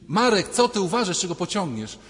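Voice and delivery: high, loud shout at a friend in danger; warning shout. Retold or imitated. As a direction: yell to stop him stepping into traffic. (ostrzegawczy krzyk do kolegi, głośno i wysoko)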